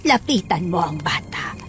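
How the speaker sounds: slow, soft whisper; whispered urging. Acted. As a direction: whisper it slowly as a coaxing command. Urging Jai towards the child. (pabulong na pag-uudyok)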